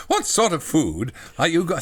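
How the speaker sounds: a hint of the childlike character; lightly voiced John. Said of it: "John says".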